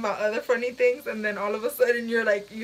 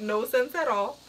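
ordinary talk

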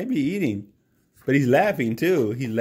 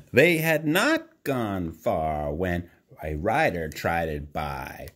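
plain reading voice

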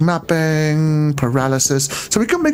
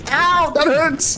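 high mock pain; 10 semitones higher, slower than usual. Pain from game hit.

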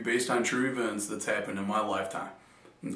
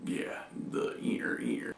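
breathy whisper, slow; hushed aside to the camera; 5 dB quieter, slower than usual. Real, not acted. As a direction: drop to a whisper mid-sentence.

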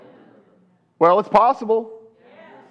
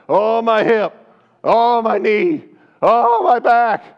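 high, slow, louder groaning complaint; mock complaining; 4 semitones higher, 3 dB louder, slower than usual. Preacher mimics aging believers moaning, high and slow. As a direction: whine about every ache.

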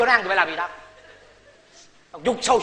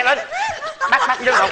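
panicked squeal. Acted. Police arrive panic.

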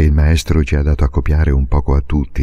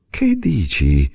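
mother caught off guard; 4 semitones higher, slower than usual. A narrator's reading. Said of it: "rispose la mamma arrossendo".

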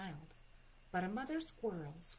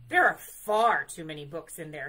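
loud protest; 3 semitones lower, 15 dB louder than usual. A narrator's reading. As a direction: bear protesting at full voice. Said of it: "he protested".

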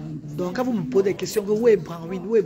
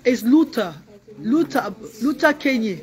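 loud, higher call of a name; 4 semitones higher, 4 dB louder, slower than usual. Real, not acted. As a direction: announce your son proudly. Loudly presenting her son. (présentation à voix haute et forte)

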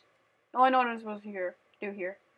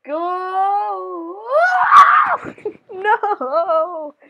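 loud, high shrieking laugh; screaming laugh; 7 semitones higher, 12 dB louder than usual. Real, not acted. Tense game moment.

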